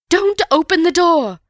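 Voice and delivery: high, loud panicked plea; loud, frantic high-pitched warning; panicked cry. A narrator's reading. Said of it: "cried Missus Stevens in a panic".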